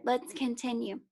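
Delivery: usual speaking voice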